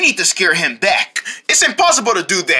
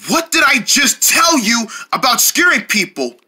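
ordinary delivery

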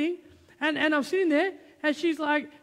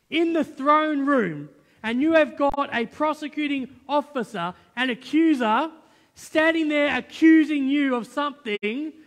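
louder, slow, emphatic pulpit delivery; preaching at full force; 5 dB louder, slower than usual. Acted. To the congregation about Jesus the lawyer, fiery preaching. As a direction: hammer home the message to the congregation. Preacher drives home the point.

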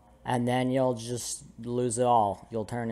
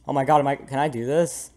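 usual speaking voice

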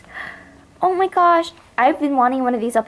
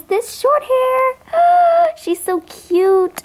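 high reveal; 10 semitones higher, much slower than usual. Real, not acted. Revealing the new pet, gasping.

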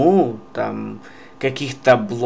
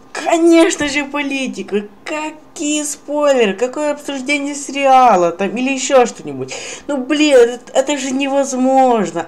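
high, sarcastic mock outrage; mock them in a high squeal; raging gamer; 10 semitones higher than usual. Real, not acted. Sarcastic mockery of comment sections.

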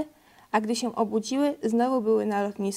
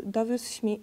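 ordinary delivery, nothing marked

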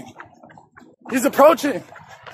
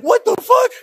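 high, imitated yelp of shock; mock startled yell; 12 semitones higher than usual. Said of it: "I jumped up like".

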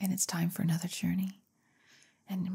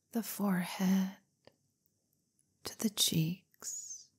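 guided hypnotherapy. Acted. Leads a hypnotherapy relaxation session.

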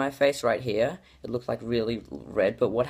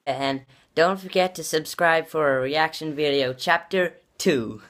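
kid voice mimic; 5 semitones higher than usual. Mimicking his kid self outro.

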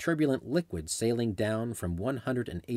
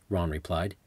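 ordinary delivery

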